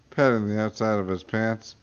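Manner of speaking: usual speaking voice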